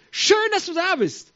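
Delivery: high, loud, drawn-out delighted greeting; joyful welcome. Acted. The Lord to those who come, joyfully. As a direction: welcome with exuberant joy. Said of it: "dann freut er sich".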